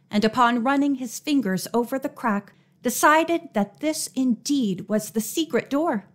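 ordinary delivery

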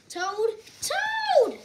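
loud, high storybook shout; urgent high call to a sleeper; crying out. A narrator's reading. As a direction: holler the name, second call stretched out. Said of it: "cried Frog".

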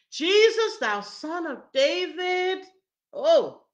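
loud, high, drawn-out plea; crying out. Acted. Blind man calling Jesus despite being hushed, loud cry. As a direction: call out desperately over the crowd. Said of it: "And he's saying it".

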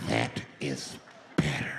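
very low, drawn-out creepy delivery; low, slow menace. Acted. Flasher savouring control of the bus, low and slow. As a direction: drop right down, word by word.

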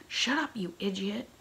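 thundering. A narrator's reading. Pirate captain silencing his foolish first mate, thundering. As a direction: cut him off with a thunderous order. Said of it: "thundered Stash".

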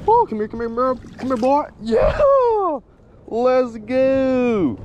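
high, thrilled calls to the fish; excited coaxing. Real, not acted. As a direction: coax the fish in, then cheer. Landing a fish, calling it in.

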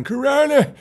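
mock howl at the night. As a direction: howl it like a coyote. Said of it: "They're howling".

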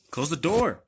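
loud, high urgent call; urgent shout. Real, not acted. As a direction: get that door shut.

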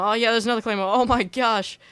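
rising thrill of surprise; excited disbelief. Real, not acted. Second mine, disbelief.

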